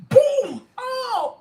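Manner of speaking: high, loud exclamation burst; real excitement. Real, not acted. Streamer overcome by her realization, excited. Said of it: "I'm so excited right now".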